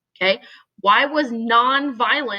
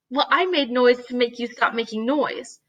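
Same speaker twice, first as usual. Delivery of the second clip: ordinary delivery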